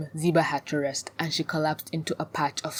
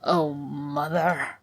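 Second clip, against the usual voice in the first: slow, drawn-out exasperation; weary sigh; much slower than usual. A narrator's reading. Ziba despairs of her young friend, sighing. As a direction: sigh the words out slowly. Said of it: "Ziba sighed".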